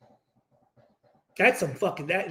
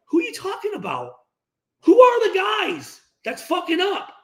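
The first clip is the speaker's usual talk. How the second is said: exasperated challenge; 10 semitones higher than usual. Retold or imitated. Player calling out vague blame, exasperated. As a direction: press him for names, fed up. Challenging Derek Carr quote.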